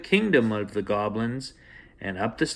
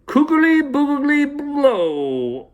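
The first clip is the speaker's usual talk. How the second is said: very high, very slow, louder goblin chant; goblin magic words; 6 dB louder, much slower than usual. Acted. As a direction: drag out a goblin's spell up high. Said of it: "said something which sounded like".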